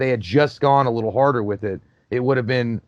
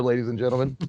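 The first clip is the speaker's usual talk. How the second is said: ordinary delivery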